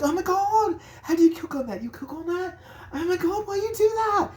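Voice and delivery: very high, wailing British lady voice; high British woman half-crying at the stove. Retold or imitated. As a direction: shriek in horror at the stove, British. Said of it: "I'm not dropping the British accent".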